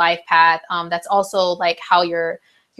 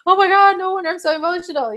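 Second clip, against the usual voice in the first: much higher, breathless mock drama; friend's emotional text; 9 semitones higher than usual. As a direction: gush like a friend overwhelmed by the moon. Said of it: "and be like".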